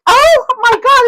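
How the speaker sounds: slow, high, loud gasp of disbelief; shocked oh my God. Retold or imitated. Passenger after the rough landing, slow high shocked cry. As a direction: stretch it out high in alarm.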